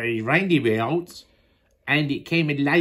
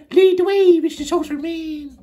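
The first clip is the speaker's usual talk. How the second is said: high character voice; 7 dB louder than usual. Acted. Child voicing a character.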